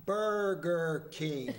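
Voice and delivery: "Burger King" stretched out very slowly; real slow. Retold or imitated. Waitress answers the wife very slowly, drawn-out words. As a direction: drag out "Burger King" word by word. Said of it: "tell her real slow".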